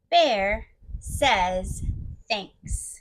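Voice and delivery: low, slow bear voice. Shown as a demonstration. Reader inviting children to join in, deep bear voice. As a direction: read it deep like a bear, pausing between words. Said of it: "Come on, join me".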